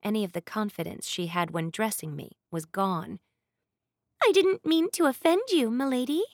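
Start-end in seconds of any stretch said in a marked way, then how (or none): 4.2-6.2 higher, meek and frightened; timid maid begging pardon; cowering plea. A narrator's reading. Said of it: "she said meekly".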